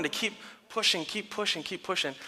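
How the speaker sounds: very soft, repeated urging; hushed encouragement. Retold or imitated. Dad keeping daughter going, hushed encouragement. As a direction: drop right down to a gentle coaxing.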